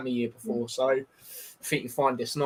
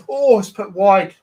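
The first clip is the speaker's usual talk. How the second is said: slow groaning oh at a miss; disappointed exclamation; 6 semitones higher, 9 dB louder, slower than usual. Real, not acted. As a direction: let it out as the shot goes wide. Reacting to a missed chance.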